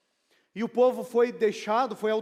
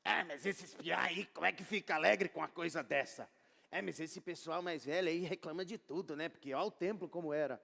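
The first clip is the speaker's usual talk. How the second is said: mock generational bickering; 6 semitones lower, 9 dB quieter than usual. Imagined quarrel between generations.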